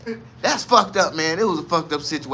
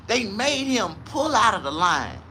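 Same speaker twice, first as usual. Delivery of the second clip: ordinary delivery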